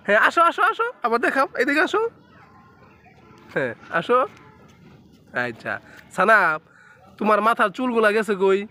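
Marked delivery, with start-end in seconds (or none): 0.1-4.2 higher, singsong coaxing to come; coaxing call. Real, not acted. Parent calling toddler to walk again, coaxing singsong.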